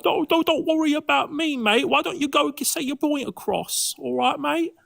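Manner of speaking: exaggerated Cockney taunting the other debater; Cockney accent. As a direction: ham up a Cockney with every mate. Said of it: "weird Cockney thing".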